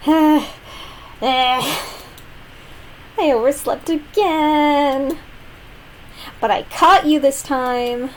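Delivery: a hint of a sleepy, cheery girl; lightly voiced cheerful girl. Voicing Sayori, game character.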